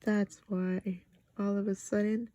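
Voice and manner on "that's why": plain reading voice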